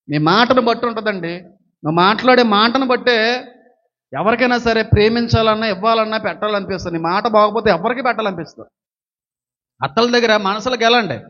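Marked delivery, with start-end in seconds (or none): none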